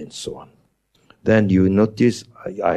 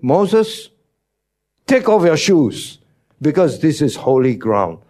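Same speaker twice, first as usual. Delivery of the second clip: voice of God; 6 semitones higher than usual. Acted. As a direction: call out as God from the bush. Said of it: "God said".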